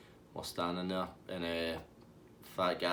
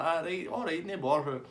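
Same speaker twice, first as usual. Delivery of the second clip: higher-pitched imitated backing down; Tasty Dave; 7 semitones higher than usual. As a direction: give in lightly, pitched up. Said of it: "He's like".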